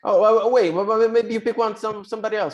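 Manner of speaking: alarmed pleading. Acted. Pleading not to be eaten.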